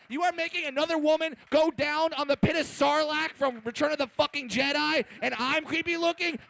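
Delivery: high, loud, outraged comic tirade; indignant rant. Acted. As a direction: tear into them in hammed-up outrage. Furious comeback rant at celebrity.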